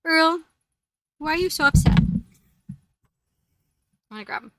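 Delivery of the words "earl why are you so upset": calling out. Real, not acted. Calling to the cat.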